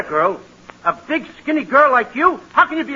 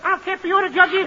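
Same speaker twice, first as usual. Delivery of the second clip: put-on high, rapid childish sass; fast high-pitched brat voice; bratty little boy; 5 semitones higher, 4 dB quieter, much faster than usual. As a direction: rattle it off as a defiant kid. Boy sasses uncle and judge.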